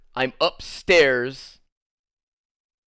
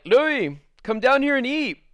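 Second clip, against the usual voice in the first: mom calling upstairs; 9 semitones higher, slower than usual. Retold or imitated. Girlfriend's mom calls Louis down to eat, high calling voice. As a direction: call him down to eat like his girlfriend's mom.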